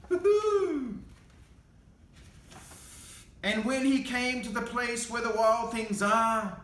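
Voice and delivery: plain reading voice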